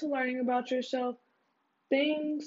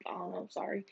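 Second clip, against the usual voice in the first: whispered apology; whispering; 9 dB quieter, much faster than usual. Real, not acted. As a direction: whisper the sorry aside.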